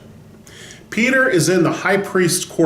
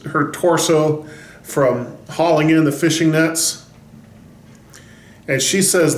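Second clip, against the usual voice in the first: ordinary talk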